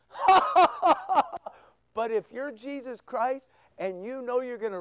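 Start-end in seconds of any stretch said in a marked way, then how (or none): 0.1-1.5 loud, high fake ha-ha laugh; mock laughter. Preacher mocking the idea of laughing on the cross, fake laughter. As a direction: act out a hammed-up guffaw. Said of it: "I don't think he was laughing going".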